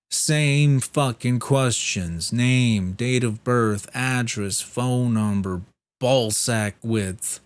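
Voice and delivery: loud, fed-up rattling off of the list; comic rant about the same questions; exasperated. Real, not acted. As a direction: bark out each item, slow and sick of it.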